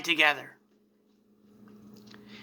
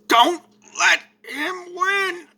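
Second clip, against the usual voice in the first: dying plea; 13 semitones higher, slower than usual. Acted. Vegeta's dying last words.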